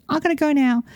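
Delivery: high, eager dog voice; chirpy dog announcing escape; dog's voice. Retold or imitated. Owner voicing dog bolting from car, high eager voice.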